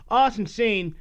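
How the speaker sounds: normal talking voice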